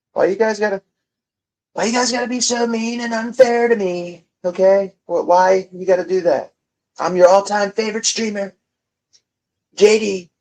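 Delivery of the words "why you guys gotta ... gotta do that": whiny. Acted. Whining at chat.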